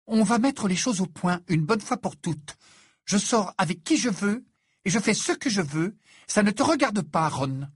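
high, heated sister's rebuke; angry telling-off. A narrator's reading. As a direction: lay down the law to your brother. Ginny quarrels with her brother.

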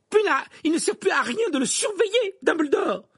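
very high, loud, piercing retort. A narrator's reading. Professor answers Dumbledore about the prisoner, piercingly. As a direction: pierce the room with it, pitched right up. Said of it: "répliqua-t-elle d'une voix perçante".

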